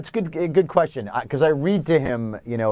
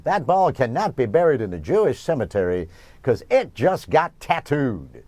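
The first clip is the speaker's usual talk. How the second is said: Brockmire's home run call; 2 semitones higher than usual. Acted. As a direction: call it like a baseball announcer, stretching the ending. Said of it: "giving nonsense home run calls as Brockmire".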